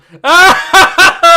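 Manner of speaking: very loud, high burst of laughter; shrieking laughter. Real, not acted. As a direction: burst out laughing at full volume. Reacts to what was sent.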